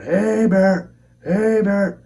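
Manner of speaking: high-pitched, drawn-out hollers; repeated yelled warning. Retold or imitated. Two cousins trying to scare off the animal, yelling. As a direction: holler it out twice, high and carrying. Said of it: "he starts yelling too".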